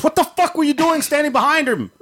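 high angry yell in dad's voice; dad going off. Retold or imitated. Dad yelling at mom for standing behind him, furious. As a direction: let the father blow up at her. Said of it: "my dad went off".

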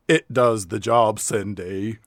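low mock Southern drawl; low, hammed-up Southern accent; Southern movie-hero accent. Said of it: "a character that spoke like this".

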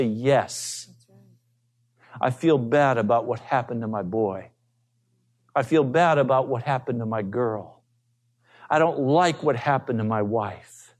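a hint of a hardened sinner owning his regret; remorseful sinner. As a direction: murmur it like a sorry old sinner confessing. Preacher voices a remorseful hardened sinner.

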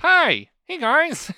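put-on high, loud eager kid greeting; thirteen-year-old kid. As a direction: greet like an excited young teen. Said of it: "I'm thirteen-year-old [name]".

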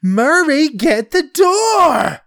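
high, drawn-out mock shout; nagging wife's loud, stretched-out call; imitated wifely yell. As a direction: holler to your husband in the next room. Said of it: "and with this tone, she's like".

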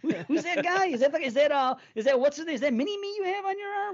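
put-on a high, puzzled young person squinting at a tattoo; confused young viewer. Young person asking about the tattoo, high and baffled. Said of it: "They're not gonna, like".

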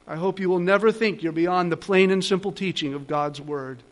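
ordinary delivery

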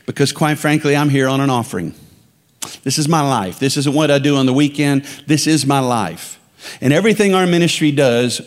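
ordinary talk, nothing marked

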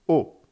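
O short, high and falling, very loud; "O" dry and punched, starting high; fourth tone. Shown as a demonstration. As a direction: drop "O" curtly from the top. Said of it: "Avec le son O".

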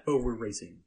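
usual speaking voice

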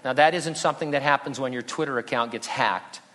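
ordinary talk, nothing marked

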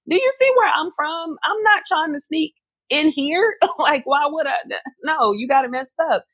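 high, exasperated "are you serious" tone; incredulous protest. Retold or imitated. To airport police accusing her of sneaking in, incredulous high protest. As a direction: protest your innocence in disbelief.

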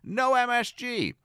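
high, loud shout of "No M S G"; phone order shouted over the din; cried out. Retold or imitated. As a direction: yell it over a noisy dining room. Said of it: "cried out".

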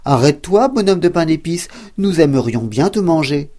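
loud, hungry shout of farmers. Acted. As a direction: call him back, loud. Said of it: "ils crièrent".

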